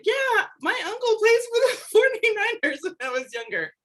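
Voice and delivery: put-on high, giggly little-kid voice breaking into laughter; childlike quote through laughter. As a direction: replay your younger self, laughing at it. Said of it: "and I was like".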